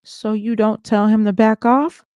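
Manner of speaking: lightly voiced screaming boyfriend. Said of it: "he screamed at me".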